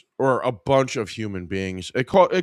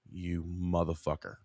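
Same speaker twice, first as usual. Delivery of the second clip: low, much softer muttered insult; 6 semitones lower, 10 dB quieter than usual. Acted. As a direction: mutter it low under your breath.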